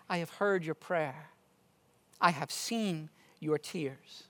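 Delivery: soft, low voice of comfort; tender, compassionate. Retold or imitated. God answering Hezekiah's tearful prayer, tender and compassionate. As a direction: quote it gently, letting each phrase settle. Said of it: "so tenderly and full of compassion".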